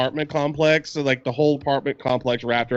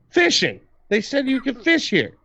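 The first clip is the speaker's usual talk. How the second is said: defensive; 9 semitones higher than usual. Retold or imitated. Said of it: "I'm like".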